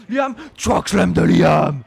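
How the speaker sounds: slow, loud chokeslam call; stretched shout, full play-by-play hype. Real, not acted. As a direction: belt out the move name. Calls a big move.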